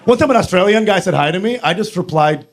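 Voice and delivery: normal talking voice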